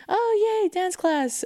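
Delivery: put-on high, bright kid's cheer; childish excitement. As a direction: squeal it like a small kid. Childhood self quoted.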